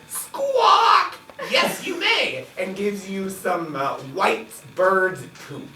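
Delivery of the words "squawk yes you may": high squawking parrot. Acted. Tavern parrot grants the drink request, squawking high. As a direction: answer like a screeching bird. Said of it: "The parrot says".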